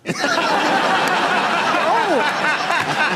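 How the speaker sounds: big high cackle; loud high laugh. Real, not acted. Laughing at the Nicholson story, loud and high.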